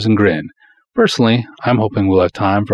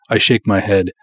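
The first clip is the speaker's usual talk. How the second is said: plain reading voice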